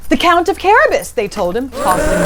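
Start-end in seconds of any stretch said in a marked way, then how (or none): 0.1-1.1 fast, high eager answer; crowd answering. Acted.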